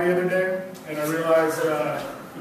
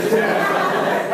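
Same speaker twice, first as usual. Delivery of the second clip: evil toucan; 5 dB louder than usual. Acted. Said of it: "my impression of Toucan Danny".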